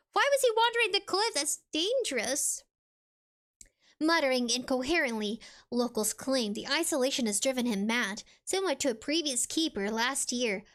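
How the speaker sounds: ordinary talk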